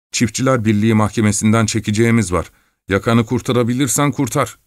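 ordinary delivery

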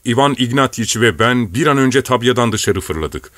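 ordinary delivery, nothing marked

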